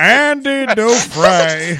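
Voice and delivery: slow, loud narrator impression; drawn-out, badly faked storyteller voice; Morgan Freeman. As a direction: announce it like a weighty film voiceover. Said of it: "I said Morgan Freeman".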